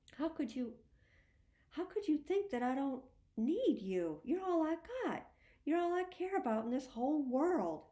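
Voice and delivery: high, loud, tear-choked plea; a broken father begging through tears; tearful pleading. A narrator's reading.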